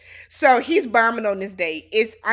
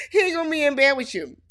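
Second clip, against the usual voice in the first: laughing through quick, higher words; amused teasing; 4 semitones higher, faster than usual. Real, not acted. Host mocks Megan's hopes for bed, laughing. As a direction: tease her while cracking up.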